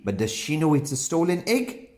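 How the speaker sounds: ordinary delivery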